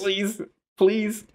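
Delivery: high, pleading whine; mock pleading. Nervous fan begging for his fighter, high pleading. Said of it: "I was like".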